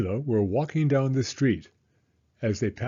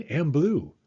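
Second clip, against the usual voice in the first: ordinary delivery, nothing marked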